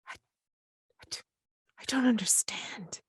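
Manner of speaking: shaky, high, breathless whisper; frightened whisper. Acted. Mildred whispers to the intruder, frightened and confused. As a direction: barely get the words out.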